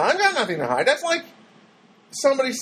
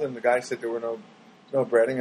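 ordinary delivery

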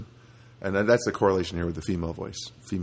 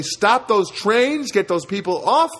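mock outburst; 9 semitones higher, 6 dB louder than usual. Biting the corned beef, he shouts a mock change of heart. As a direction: ham it up like one bite changed your mind. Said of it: "you would say".

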